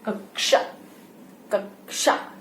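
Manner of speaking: कक्षा said slowly as kəʃa, soft sh sound; sound of क्ष. Shown as a demonstration. Teacher modelling कक्षा for kids, soft and careful. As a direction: demonstrate कक्षा as kə-sha. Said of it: "जो sound लिखते हम इसको ऐसे हैं".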